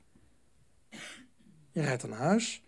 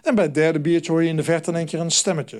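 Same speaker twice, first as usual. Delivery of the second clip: usual speaking voice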